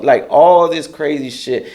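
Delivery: ordinary talk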